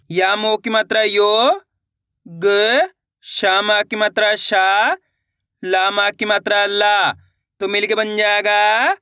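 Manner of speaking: slow, each letter and its vowel sign named, ending on a rising tone; walk the children through every letter; slow sounding out. Shown as a demonstration. Teacher showing pupils how to sound out letters, slow and deliberate. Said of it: "चलिए एक बार फिर से देखिए".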